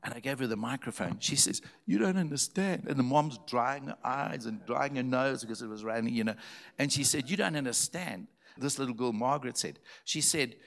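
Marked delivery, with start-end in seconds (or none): 1.9-2.8 put-on much higher, excited girl's voice; imitated girl. 7.3-8.3 imitated girl.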